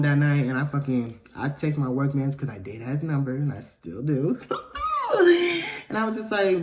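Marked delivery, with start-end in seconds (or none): 4.4-5.8 high-pitched burst of laughter. Real, not acted. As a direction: laugh out high at your own confession. Laughs at own confession.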